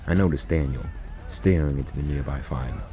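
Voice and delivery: usual speaking voice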